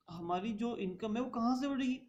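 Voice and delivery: normal talking voice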